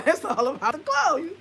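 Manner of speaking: high, loud laughing words; real laughter. Real, not acted. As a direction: laugh at the glow obsession. Mocking the glow idea.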